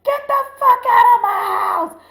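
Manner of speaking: high, loud, full-out shout; furious yell. Retold or imitated. Yelling at a grown man while mom listens, furious shout. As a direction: re-enact yelling him out the door. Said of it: "yell at this grown-ass man and be like".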